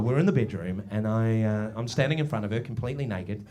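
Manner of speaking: usual speaking voice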